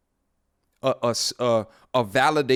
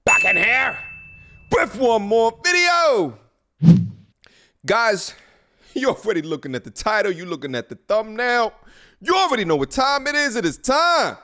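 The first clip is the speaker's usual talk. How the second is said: high, loud YouTube host intro; hyped vlog intro; 8 semitones higher, 4 dB louder than usual. Real, not acted. Host greeting YouTube viewers to one more video, hyped intro. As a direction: shout the welcome like a hype man.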